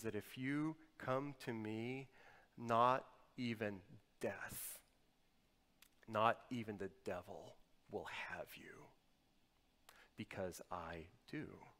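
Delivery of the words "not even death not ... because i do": slow hushed emphasis. Acted. Slow quiet climax of claim.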